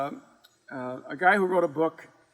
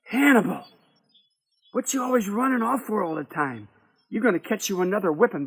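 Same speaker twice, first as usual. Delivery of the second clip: scolding; 4 semitones higher, faster than usual. Acted. Sarah scolds the runaway boy.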